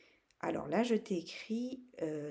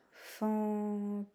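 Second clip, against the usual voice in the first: "fantôme" drawn out on a held pitch; syllable by syllable; 3 semitones higher than usual. Shown as a demonstration. Teacher showing three syllables, syllable by syllable. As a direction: stretch "fan", "tô", then hum the "me". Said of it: "Trois syllabes".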